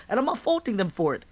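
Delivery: ordinary talk, nothing marked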